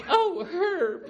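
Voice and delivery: a fond, laughing scold of her husband; Helen laughing. Retold or imitated. Helen voiced reacting to joke.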